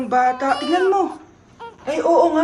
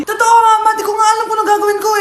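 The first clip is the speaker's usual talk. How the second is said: higher, louder, fast protest; flustered pleading; 5 semitones higher, 4 dB louder, faster than usual. Acted. Child to mother about the crying baby, fast flustered plea. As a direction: rush it out in a panic.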